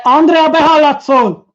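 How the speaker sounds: real shout, high and loud; calling out loudly. Real, not acted. Interrupting a take to call to Andrea, shouted. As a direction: holler it across to someone. Calls out to Andrea mid-recording.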